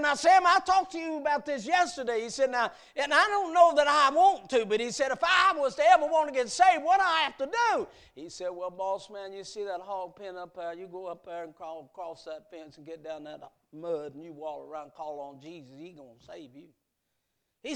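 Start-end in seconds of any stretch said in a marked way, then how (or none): 0.0-7.9 lightly voiced plantation owner, higher and louder; a hint of an impatient master pressing his servant; boss man. 8.5-16.7 lightly voiced servant, lower and softer, fading away; gentle, unhurried old servant giving directions; Sam the servant.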